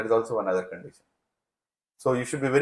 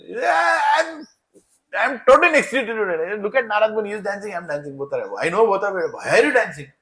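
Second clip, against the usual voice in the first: much higher, crying-out giddy joy with a laugh; ecstatic; 8 semitones higher, 4 dB louder than usual. Acted. Shiva answering his worried wife Parvati, giddy with ecstatic joy. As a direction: blurt it out overwhelmed with delight.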